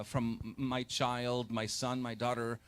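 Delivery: ordinary talk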